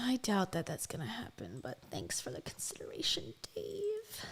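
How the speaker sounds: whispered deadpan sarcasm; sarcastic whisper. Real, not acted. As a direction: whisper it dryly under your breath. Sarcastic aside to clip.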